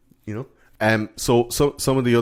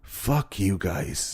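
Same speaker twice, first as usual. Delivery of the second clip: defiant; 6 dB quieter, much slower than usual. Retold or imitated. Horace turning on the bullies, slow and softer. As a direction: mutter it slowly, done with them. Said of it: "And he's like".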